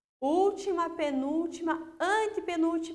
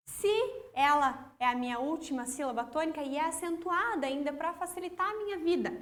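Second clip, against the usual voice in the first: ordinary talk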